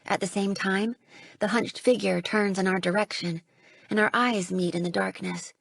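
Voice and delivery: ordinary delivery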